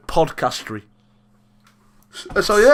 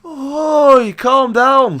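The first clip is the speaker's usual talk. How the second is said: sharp higher, louder "Oi"; mock shout; 10 semitones higher, 7 dB louder, much slower than usual. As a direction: bark it like a bloke on a night out. Mocking Alex's catchphrase.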